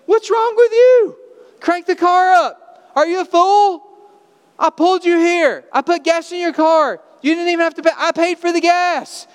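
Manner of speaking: loud, high exasperated yelling; angry scolding. Pretend. Helper yelling at the man pushing his car, angry. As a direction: berate him like a fool for wasting help.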